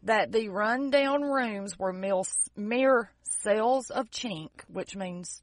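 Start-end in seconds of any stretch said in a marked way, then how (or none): 0.3-4.5 slow, deliberate, word by word; drawn-out, careful enunciation; slow repeat. Real, not acted. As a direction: repeat it slowly for a confused listener. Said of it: "Say that again".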